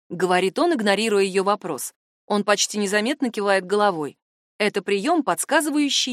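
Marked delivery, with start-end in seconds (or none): none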